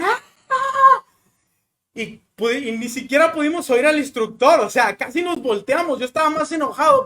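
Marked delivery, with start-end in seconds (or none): 0.0-1.0 a short high shriek of "ah", hammed up; high mock scream. The girls screaming down the waterfall, mock shriek. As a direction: shriek "ah" twice, high and loud.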